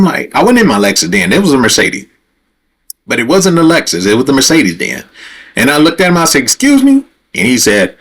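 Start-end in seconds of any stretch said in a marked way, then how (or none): 6.4-7.0 high-pitched, offended question; affronted. Retold or imitated. Driver to prying border officer, affronted. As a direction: act out being taken aback by the nerve.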